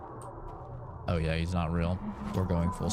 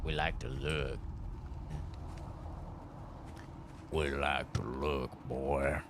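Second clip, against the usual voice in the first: creepy low voice; 8 semitones lower, 10 dB quieter, slower than usual. Pretend.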